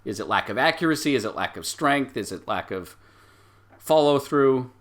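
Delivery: normal talking voice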